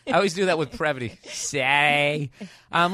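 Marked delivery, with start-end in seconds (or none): 1.2-2.3 slow Boston "Saturday" with the consonants dropped; Boston accent. Shown as a demonstration. Host repeating the Boston word, slow Boston accent. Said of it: "There's no consonants in that word".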